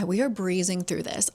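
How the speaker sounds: ordinary delivery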